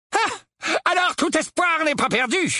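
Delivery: high-pitched, spiteful uncle; nasty glee. A narrator's reading. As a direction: gloat meanly in a high voice. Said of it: "avec méchanceté". (méchanceté jubilatoire, voix aiguë)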